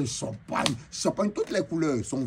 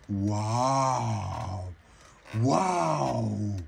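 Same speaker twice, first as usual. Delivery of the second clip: long, low, awed crowd waouh; crowd's drawn-out wow; 4 semitones lower than usual. Retold or imitated. Brazilian crowd marveling at the costume, drawn-out awe. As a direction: sound like a whole crowd gasping in awe.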